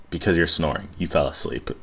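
normal talking voice

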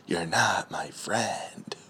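drawn-out, voiceless whisper; slow whisper. Acted. Comedian finishing his repeated joke line, slow whisper. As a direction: whisper it very slowly as a punchline.

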